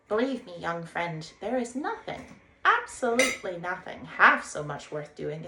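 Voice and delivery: cheerful Rat calling a greeting; a lively riverbank rat hailing a stranger; Rat's voice. Acted. Rat rhapsodises on boats.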